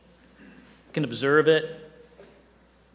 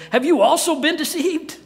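scoffing Pharisees; 12 semitones higher, 6 dB louder than usual. Retold or imitated.

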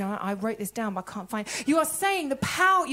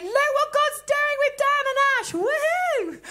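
high, loud joyful cheer; much higher, louder celebrating shout; excited celebration; 12 semitones higher, 5 dB louder than usual. Real, not acted. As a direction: whoop it up for friends. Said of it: "Agree with God and celebrate".

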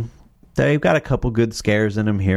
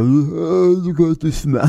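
victim voice; 8 semitones higher, slower than usual. Retold or imitated. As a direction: gasp it out like a dying victim. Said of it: "they go".

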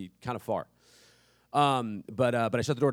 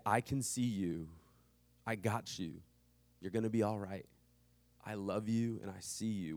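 tender reassurance; 2 semitones lower, 5 dB quieter than usual. Acted. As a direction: say it gently, like a father.

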